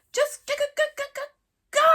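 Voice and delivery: high, loud stuttered scream. Acted. Hiccup screaming at the clinging dragon, stuttered yell. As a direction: lose it, stammering the order. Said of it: "screamed Hiccup".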